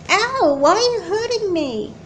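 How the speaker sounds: high, hurt whimpering question; pained plea. Acted. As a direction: cry out in pain. Being hurt by sister.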